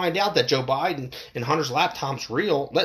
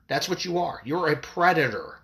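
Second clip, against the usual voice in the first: ordinary talk, nothing marked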